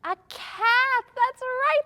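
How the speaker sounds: high-pitched, loud cheer; delighted praise for a right answer; excited reveal. Pretend. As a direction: exclaim as if the kids just guessed it. Cheering children's correct guess.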